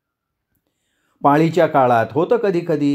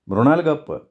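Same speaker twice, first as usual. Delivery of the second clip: ordinary delivery, nothing marked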